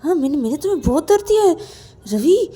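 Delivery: loud, high agitated outburst; anguished self-blame. Acted. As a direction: blame yourself loudly, voice pitched high. Rahul's anguished self-blame in the story. (व्याकुल होकर ऊँची आवाज़ में खुद को कोसना)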